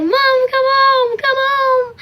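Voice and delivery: high, loud, drawn-out wailing; crying plea. Retold or imitated. Hurt girl begs her mom on the phone, high crying. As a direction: beg her mother through tears.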